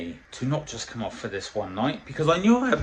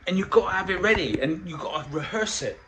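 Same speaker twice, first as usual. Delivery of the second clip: usual speaking voice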